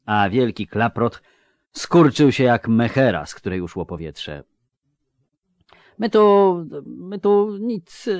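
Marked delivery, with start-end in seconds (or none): 6.0-8.1 seplenienie przez szczerbate zęby; high, slow lisping mumble. Acted. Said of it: "zaseplenił Szczupak". (sepleniący głos)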